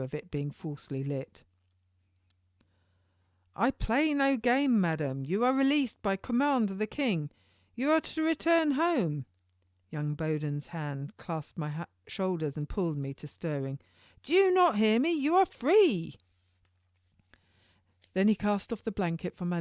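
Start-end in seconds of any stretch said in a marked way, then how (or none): 3.6-9.2 put-on much higher, louder young jailer; turnkey's voice. Young turnkey telling Madam Cellier she is free, high and loud. Turnkey explains her release. 14.2-16.2 put-on much higher, louder young jailer; turnkey's voice. Young turnkey telling Madam Cellier she is free, high and loud.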